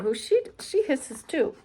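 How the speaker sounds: usual speaking voice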